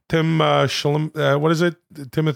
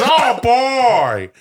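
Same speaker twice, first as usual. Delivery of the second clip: high, loud hammy holler; Robert De Niro doing Al Pacino; 8 semitones higher, 5 dB louder than usual. Acted. De Niro quoting his friend Al, loud mock Pacino holler. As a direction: belt out a drawn-out actor impression. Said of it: "Al Pacino says".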